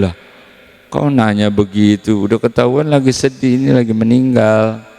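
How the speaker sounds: mourners scoffing. Retold or imitated. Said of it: "Ya mereka bilang".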